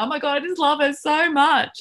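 high, loud mock-weepy gush; retold hysterical bawling. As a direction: imitate yourself bawling with overwhelming love. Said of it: "literally bawling, like, hysterical".

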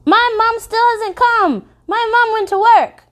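put-on high, slow little-girl plea; child's plea. A narrator's reading. Little girl begging the narrator to find her mom, high pleading. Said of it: "grasping my forearm".

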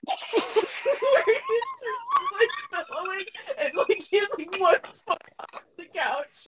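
high-pitched, barely getting it out for laughing; cracking up mid-description. Real, not acted. Co-host pictures Colin posing as a pirate, laughing hard.

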